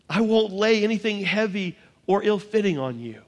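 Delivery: normal talking voice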